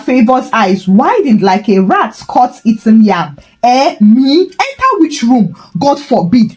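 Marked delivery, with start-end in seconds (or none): 3.6-6.6 high, outraged squeal of denial; shocked denial. A narrator's reading. Mama Favor caught out, denying in a high, shocked voice. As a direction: fling the accusation back, pitched high.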